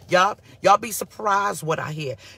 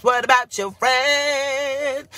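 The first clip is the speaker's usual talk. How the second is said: slow, louder, higher drawn-out question; 6 semitones higher, 4 dB louder, much slower than usual. Real, not acted. Pastor teasing the sermon topic, slow and drawn out. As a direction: stretch it for the congregation. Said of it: "drawn-out rhetorical question".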